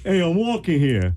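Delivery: high, slow New York pedestrian's protest; New York accent. Shown as a demonstration. Guest repeating accent on host's request, exaggerated New York. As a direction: holler it like an indignant New Yorker. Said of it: "Do that again".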